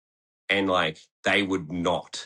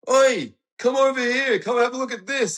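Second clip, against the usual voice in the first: imitating a kid calling out; 6 dB louder than usual. Kid at the skate park calling his mates over, high put-on shout. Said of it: "he just goes like".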